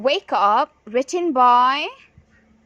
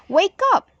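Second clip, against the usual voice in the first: exclamatory; 8 semitones higher, 4 dB louder than usual. Shown as a demonstration. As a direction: Wake up sung out high and bright with a rising end. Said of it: "So you have to read it like this".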